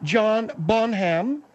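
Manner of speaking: slow, deliberate BONHAM as two separated syllables, "bon-HAM"; mock French pronunciation. Guest insisting that she said French, mock French pronunciation. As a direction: draw out both halves of BONHAM, "bon... ham". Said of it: "Yes, I said French".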